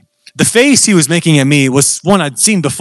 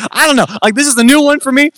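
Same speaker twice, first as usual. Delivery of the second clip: high-pitched, nervous protest; mock-panicked admission of being new to this; 10 semitones higher, faster than usual. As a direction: act out your own nervous doubt, pitched way up. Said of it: "And so I was nervous".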